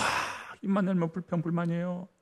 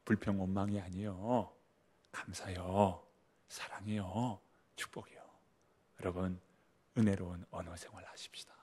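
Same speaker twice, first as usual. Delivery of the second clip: whisper; 7 semitones lower, 7 dB quieter than usual. Real, not acted. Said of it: "whispered exhortation".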